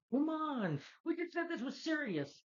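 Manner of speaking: very high, hammed-up indignant squeal; mock freaked-out protest. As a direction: imitate someone mad and panicking, voice shooting up. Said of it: "he's mad and freaked out".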